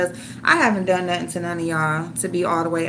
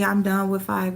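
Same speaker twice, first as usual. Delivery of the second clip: normal talking voice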